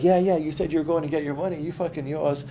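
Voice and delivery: put-on insistent kid. Kids insisting he owes them.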